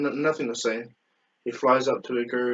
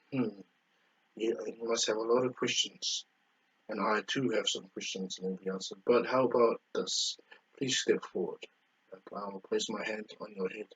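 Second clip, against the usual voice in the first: put-on softer, calm old sage speaking kindly; soft elder voice; 6 dB quieter than usual. Guru welcoming Goku, soft and calm.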